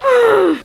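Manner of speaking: sharp, high gasp; loud exasperated gasp. Real, not acted. Exasperated reaction to the book.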